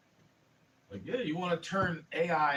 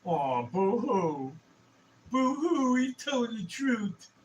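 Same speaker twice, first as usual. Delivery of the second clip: mock crying; 7 semitones higher, 3 dB louder, much slower than usual. Mocking fake crying over critics.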